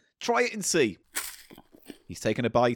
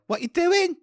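put-on Essex accent; 11 semitones higher, slower than usual.

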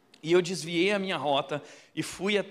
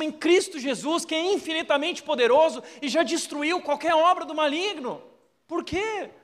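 higher, louder, insistent preaching; fervent preaching; 6 semitones higher, 4 dB louder than usual. Acted. Pastor urges the church to seek Christ, fervent preaching. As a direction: drive the message home to the congregation.

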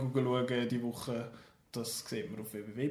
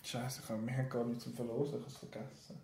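dropping to a guilty near-whisper; hushed confession, trailing off; panicked whisper; 3 semitones lower, 12 dB quieter than usual. Real, not acted. Admits forgetting the giveaway prize.

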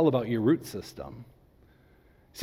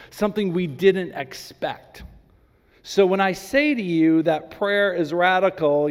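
ordinary talk, nothing marked

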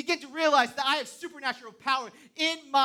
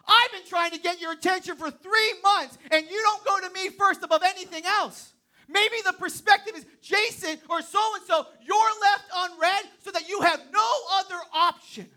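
God calling a distracted child back, raised voice; voicing God; 7 semitones higher, 6 dB louder than usual. God to someone worried about texts, lightly voiced and louder. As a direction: plead like God who has waited three months.

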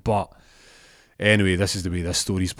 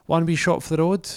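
high-pitched, tempting offer; wavy barmaid; 9 semitones higher, 3 dB louder than usual. Acted. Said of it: "she's a bit wavy".

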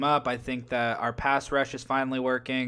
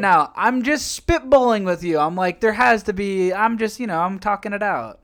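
excited defensive; 7 semitones higher, 8 dB louder than usual. Real, not acted. Defending his pick loudly.

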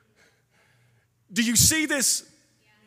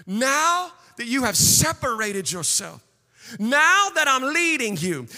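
building, declamatory sermon delivery; fervent preaching; 7 semitones higher than usual. Acted. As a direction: preach it out in long, driving phrases. Preaches at full force.

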